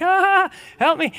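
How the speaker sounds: put-on high-pitched dangling kid's plea; mock panicked cry. Preacher acting out a child dangling from merry-go-round, mock panicked cry. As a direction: cry out as if spun too fast. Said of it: "someone spins it so fast and you're, like, dangling".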